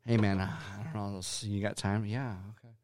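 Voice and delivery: quoting a friend softly and seriously; a low, hesitant man-to-man phone opener; mock-serious hushed buddy voice; hushed imitated phone call.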